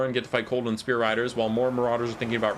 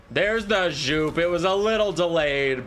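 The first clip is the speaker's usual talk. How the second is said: higher, drawn-out cheer; excited call-out; 6 semitones higher, 4 dB louder, slower than usual. Real, not acted. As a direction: call out the long-awaited hit, stretching it. Caster celebrates the awaited move.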